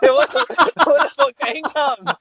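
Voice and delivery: high, incredulous retort; mock disbelief. Firefighter retelling his own amazed reply in a high voice. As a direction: act stunned and squeak it out. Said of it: "And I was like".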